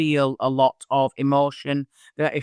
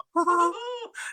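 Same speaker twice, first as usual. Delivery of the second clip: a sudden squeaky high giggle; high pitch giggle; 5 dB quieter than usual. Shown as a demonstration. Medium demonstrating her giggle to the interviewer, squeaky high giggle. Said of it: "It's like a right high pitch giggle".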